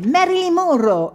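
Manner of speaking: high, excited name call; quick retort. A narrator's reading. Ricky hits back in the name game, excited. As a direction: hit back with the name, pitched right up. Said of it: "Ricky ribatté".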